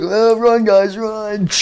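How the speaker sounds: high, loud cry to flee; panicked call. Acted. Chica urges others to flee.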